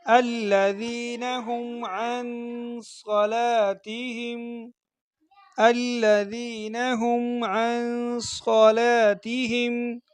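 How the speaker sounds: very slow melodic tajweed; measured Quranic chant; Quran recitation. Shown as a demonstration. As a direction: draw out each word like a reciter.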